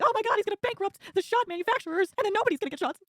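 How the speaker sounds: high, hammed-up panicky impression of Warren; mock freak-out. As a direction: squeal it like an overwrought politician. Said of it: "Elizabeth Warren freaking out".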